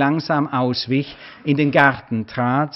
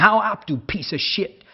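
higher, mock angry yell after him; 7 semitones higher than usual. A narrator's reading. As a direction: hurl it after the stranger. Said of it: "schrie er mir noch mal nach".

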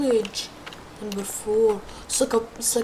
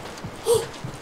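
sharp gasp; 8 semitones higher, 7 dB quieter than usual. Real, not acted. Sees clock tower.

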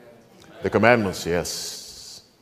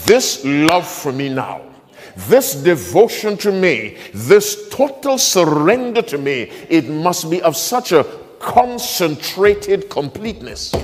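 higher, slower building insistence; rising emphatic repeat; 5 semitones higher, slower than usual. Shown as a demonstration. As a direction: climb in pitch to make them hear it. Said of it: "you didn't hear what I said".

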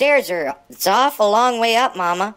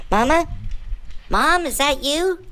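normal talking voice

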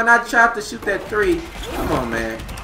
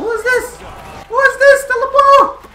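much higher, loud disbelief; shocked outburst; 6 dB louder than usual. Real, not acted. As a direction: cry out in stunned disbelief. Shocked at the game.

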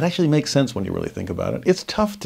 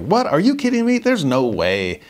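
mock disbelief, jumping much higher on kidding me; disbelieving crowd; 6 semitones higher than usual. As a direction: play the stunned crowd who can't accept it. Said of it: "everyone's like".

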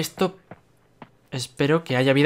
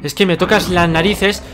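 higher, louder fed-up rant; angry outburst; 4 semitones higher, 6 dB louder than usual. Real, not acted. Gamer yelling at an enemy that won't attack, angry outburst. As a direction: snap at the enemy for stalling.

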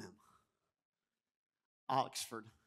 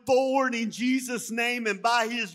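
louder, higher preaching that climbs to the end; full-force preaching; 6 semitones higher, 8 dB louder than usual. Acted. Pastor rallying the church to serve wholeheartedly, preaching hard. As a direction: declare it with all your heart.

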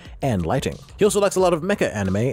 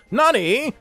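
surprised Japanese exclamation; 9 semitones higher than usual. Acted. Anime exclamation.

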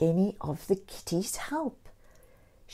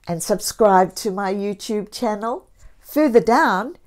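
ordinary delivery, nothing marked

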